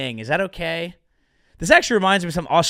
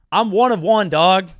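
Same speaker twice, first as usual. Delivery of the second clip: loud, punchy catchphrase opener; hype shout; 3 semitones higher, 7 dB louder than usual. Acted. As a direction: bark it out like a hype intro.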